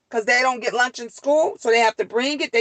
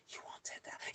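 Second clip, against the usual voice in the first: very soft, breath-only whisper; 30 dB quieter, faster than usual. Shown as a demonstration. Mother demonstrates what kids always hear, whispering. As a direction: drop to a secretive whisper. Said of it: "you could just whisper".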